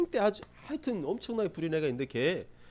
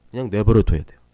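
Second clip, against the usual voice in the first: emphatic loud; 6 semitones lower, 14 dB louder than usual. Shown as a demonstration. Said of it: "emphatic instruction".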